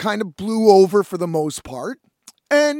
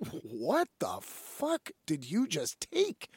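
slow, softer incredulous question; stunned, word-by-word retelling of disbelief; baffled disbelief; 4 semitones lower, 10 dB quieter, slower than usual. Retold or imitated. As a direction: draw it out quietly, rising at the end. Retold bafflement at drunk host.

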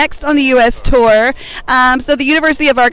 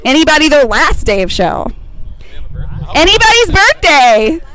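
calling out; 4 semitones higher than usual. Real, not acted. Calling out across parking lot.

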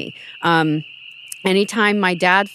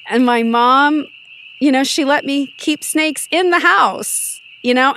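normal talking voice

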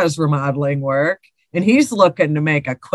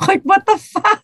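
laughing disbelief; 14 semitones higher, 4 dB louder than usual. Real, not acted. Laughing disbelief at peeking ghost.

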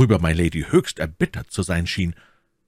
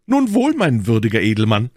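loud, raised gallant's address; spirited challenger; 4 semitones higher, 5 dB louder than usual. Acted. D'Artagnan accepting the Englishman's terms, spirited. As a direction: ring it out with mock courtesy.

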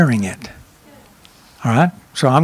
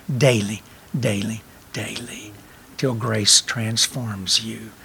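slow, soft, fading repetition of daily; hushed repetition; 6 semitones lower, 7 dB quieter, slower than usual. Real, not acted. Preacher drawing the congregation in, slow and hushed. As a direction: let each daily fall quieter and slower.

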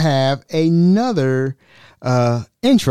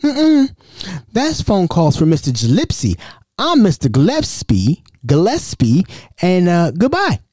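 mocking voice; 6 semitones higher than usual. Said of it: "I said".

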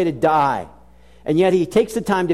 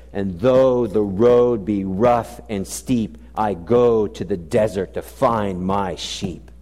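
slow and low, line by line; slow hymn recital; 7 semitones lower, slower than usual. Shown as a demonstration. Preacher reciting the shepherd's hymn, slow and low. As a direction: lean into each word of the hymn. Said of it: "And then it says this".

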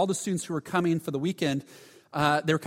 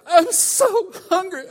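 put-on high, slow whimper of a starving kid; mock whiny hungry kid; 14 semitones higher, 7 dB louder, slower than usual. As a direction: play a wailing hungry kid, much higher. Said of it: "They're like".

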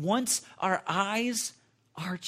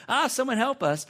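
high, loud mock panic cry; retold scream; 8 semitones higher, 6 dB louder than usual. Family freaking out as girl chokes, mock scream for help. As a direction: call for help in hammed-up alarm. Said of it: "We're screaming".

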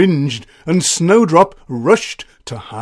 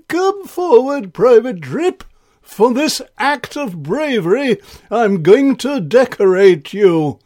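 higher, grand royal voice; Ice King voice; 8 semitones higher, 5 dB louder than usual. Acted. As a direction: summon him forward regally. King speaks to Drip.

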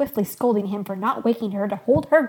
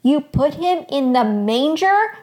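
louder, higher, slow incredulous question; aghast at what he did; appalled disbelief; 5 semitones higher, 6 dB louder, slower than usual. A narrator's reading. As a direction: stretch it out in loud disbelief. Mother appalled at baby in manger.

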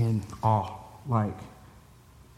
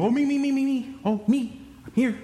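put-on high, excited little-kid voices; eager kids raising hands; 13 semitones higher than usual. Dad imitating the girls' eager hand-raising in high voices. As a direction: squeal like kids dying to be picked. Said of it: "you go".

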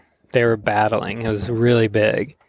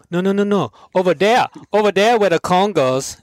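higher, louder mock pidgin argument; arguing Hawaiian uncle; 8 semitones higher, 3 dB louder than usual. Uncle insisting where the cone goes, in mock Hawaiian pidgin. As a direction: bicker like a stubborn island uncle. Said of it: "the first guy's like".

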